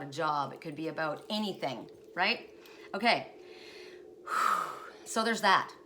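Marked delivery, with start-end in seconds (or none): 3.3-5.0 sigh. Real, not acted. Sighs after emotional story.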